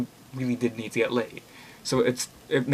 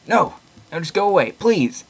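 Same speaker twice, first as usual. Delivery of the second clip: higher, louder, strained begging; desperate plea; 7 semitones higher, 6 dB louder, slower than usual. Acted. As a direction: push him away, terrified of yourself. Killer pleads, flees.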